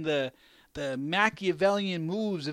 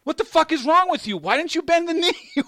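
high, loud mock scolding, breaking into a laugh; exasperated mock telling-off that cracks up; characters scolding Jon; 9 semitones higher, 5 dB louder than usual. Every character scolding Jon, high and loud through laughter. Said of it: "every character turned around and said to him".

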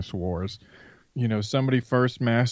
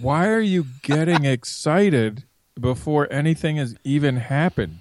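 ordinary talk

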